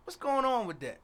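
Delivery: fast, high indignant rant. Real, not acted.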